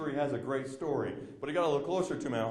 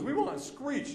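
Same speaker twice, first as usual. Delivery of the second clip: much higher, a crowd member calling out; mock heckler; 12 semitones higher, much slower than usual. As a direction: chirp it up high like a fan shouting from the seats.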